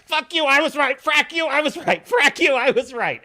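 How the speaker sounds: shouted gloat in Razor Fist's style. Retold or imitated. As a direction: bellow it out like a triumphant ranter. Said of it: "as Razor Fist would say".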